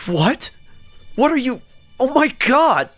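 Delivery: high, alarmed outburst; startled high-pitched exclamations building to a yell; panicked shock. A narrator's reading. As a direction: cry out in rising horror. Victim reacts to killer's confession.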